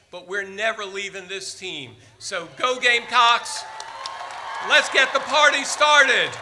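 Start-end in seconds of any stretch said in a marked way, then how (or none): 2.6-6.3 loud, high cheer to the crowd; rally shout. Real, not acted. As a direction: fire up the crowd, full voice.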